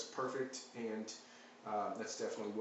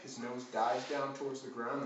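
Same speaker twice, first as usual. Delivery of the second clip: ordinary talk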